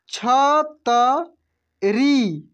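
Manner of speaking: छतरी stretched very slowly, each syllable separate. Shown as a demonstration. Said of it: "कैसे पढ़ेंगे?". (छतरी को धीरे धीरे खींचकर बोलिए)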